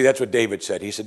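ordinary talk, nothing marked

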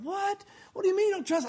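high, baffled what; shocked question. Retold or imitated. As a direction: play the offended listener. Shocked listeners voiced.

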